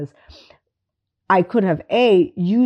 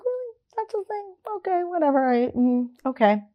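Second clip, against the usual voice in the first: mock disbelief; 11 semitones higher, 4 dB quieter than usual. Reacting to students' so-called traumas, mock incredulous. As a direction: play it as if you can't believe it.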